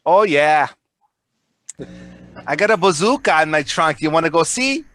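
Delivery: mock Minnesota tough guy bragging; Minnesota accent. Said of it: "that Minnesota accent".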